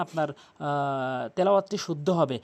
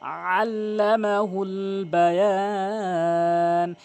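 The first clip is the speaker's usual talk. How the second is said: slow, drawn-out melodic tajweed chant; Quran recitation; 3 dB louder, much slower than usual. Acted. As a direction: recite each word long and sung. Recites the verses.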